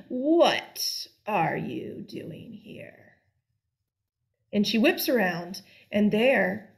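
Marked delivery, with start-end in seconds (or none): 0.1-3.2 slow, breathy witch whisper; slow menacing whisper from behind. Acted. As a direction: creep up and hiss it slowly. Witch appears behind the girl.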